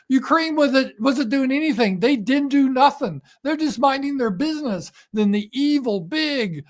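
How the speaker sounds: high-pitched, simplistic explainer impression; Kamala Harris. Host channeling a politician on a radio station, mocking impression. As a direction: talk high, as if explaining to children. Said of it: "She was talking like this".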